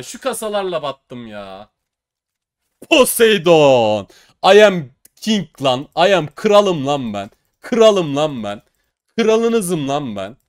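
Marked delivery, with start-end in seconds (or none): 2.9-4.1 slow, loud drawn-out call of Poseidon; excited shout. Real, not acted. Calls out the big drop. 4.5-10.4 loud, slow chant of kralım lan ben; gloating like a king; boastful crowing. Real, not acted. Boasting after the big win.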